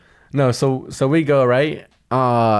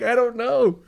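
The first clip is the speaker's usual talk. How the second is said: mock bafflement; 10 semitones higher, slower than usual. Retold baffled reply amid laughter.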